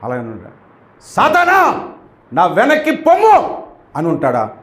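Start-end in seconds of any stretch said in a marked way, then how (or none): 1.0-3.6 a forceful rebuke from Jesus; commanding rebuke. Shown as a demonstration. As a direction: order Satan away with full authority. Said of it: "ఇలాగే అని ఉంటాడు".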